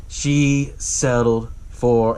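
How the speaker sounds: very slow, deliberate words; slow taunt. Retold or imitated. Tom gets in Axel's head about his wife, slowly taunting. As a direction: imitate needling someone, dragging out each word. Tom needling Axel, cut short.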